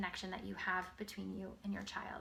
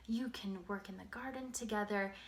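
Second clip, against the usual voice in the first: ordinary delivery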